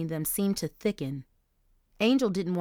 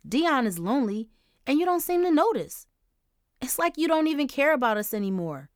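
higher, louder angry tirade; fuming; 5 semitones higher, 4 dB louder than usual. Acted. Daughter fuming at her grieving mother, angry. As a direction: let the resentment pour out. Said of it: "Angel fumed".

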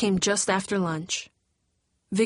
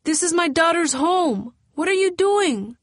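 broken English; 9 semitones higher, 5 dB louder than usual. A narrator's reading. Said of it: "asked in broken English".

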